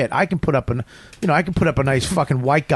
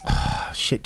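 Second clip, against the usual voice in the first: soft, voiceless sigh of dismay; exasperated whisper; 6 dB quieter than usual. Retold or imitated. Comic replays his dismay at the cab, breathed out. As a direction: let it out under your breath.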